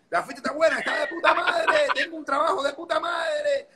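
high, hammed-up Spaniard from Spain; Spanish accent. Imita a españoles exagerando. (acento español exagerado y agudo)

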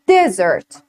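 Desert with stress on the first syllable, falling. Shown as a demonstration. Teacher models Desert for the class, stress first. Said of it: "Repeat after me".

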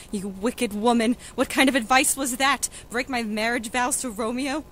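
loud indignant scolding; angry accusation. Acted. Juliet scolding the nurse for her advice, angry. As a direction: lash out at her in outrage.

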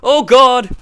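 real high, very loud outburst; loud startled cry; startled yell. Real, not acted. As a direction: yell out in sudden alarm. Startled by sudden game action.

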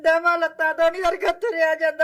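real weeping complaint; crying. Real, not acted. Ailing mother telling visitor she cannot walk, crying. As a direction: speak through tears. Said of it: "ਨਹੀਂ, ਨਹੀਂ, ਤੁਸੀਂ ਰੋ ਨਾ।".

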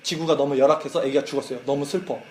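낮고 조용하게 가라앉은 아들의 슬픈 목소리; 힘없이 털어놓는 나쁜 소식; sad message. Retold or imitated. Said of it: "근데 그 다음 영상". (슬픔을 억누르며 낮고 조용하게)

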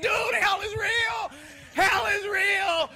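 a frantic man grabbing a cop and insisting hell is real; urgent shout. Retold or imitated. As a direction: shout it slowly into the cop's face.

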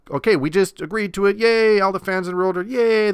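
lightly voiced owners cheering; mock cheering fans. Owners pretending to celebrate the new deal, mock cheer. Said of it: "Why don't you just start".

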